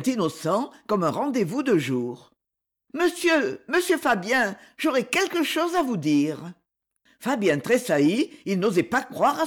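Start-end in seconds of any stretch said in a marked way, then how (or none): 3.0-6.5 higher-pitched, lightly voiced young lady; young woman's voice. A narrator's reading. Cyprienne addressing Fabien at night, young woman's voice. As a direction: call to him in a high, polite voice.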